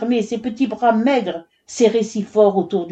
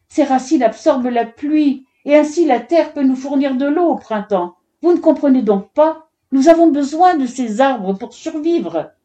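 high, urgent pleading; imploring; 7 semitones higher, 3 dB louder than usual. A narrator's reading. Mother explaining why they need trees, imploring. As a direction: implore him, growing more desperate. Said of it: "l'implora la mère d'Amrita".

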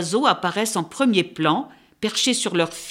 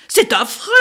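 a raised cry, a touch higher and louder; mock shriek; 5 semitones higher, 4 dB louder than usual. A narrator's reading. Titmouse cries out at the crow, mild mock shriek. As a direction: let the titmouse cry out in disgust. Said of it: "hurla la mésange".

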